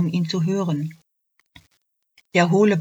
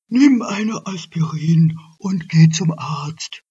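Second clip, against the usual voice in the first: Herr Elch; 6 dB louder than usual. Acted. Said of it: "Der Herr Elch sagte".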